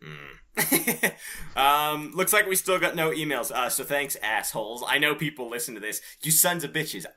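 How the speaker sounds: ordinary talk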